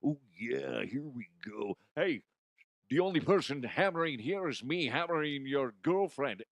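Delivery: hammed-up wrestler trash talk; Macho Man impression. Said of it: "I just did the wrong voice".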